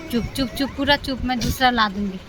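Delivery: quiet shushing with a new promise; soft hushing. Real, not acted. Hushing crying child. (रोती बच्ची को फुसफुसाकर चुप कराना)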